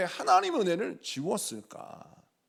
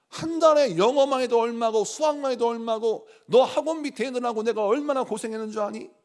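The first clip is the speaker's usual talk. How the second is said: louder, higher indignant parent's tirade; angry parent scolding; 4 semitones higher, 6 dB louder than usual. Retold or imitated. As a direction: scold like a parent robbed of credit. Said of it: "막 화를 냈다는 거예요".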